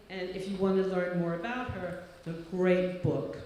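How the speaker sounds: ordinary talk, nothing marked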